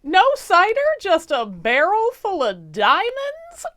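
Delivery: the king squeaks in disbelief at the diamonds; baffled king. Acted. King finds diamonds instead.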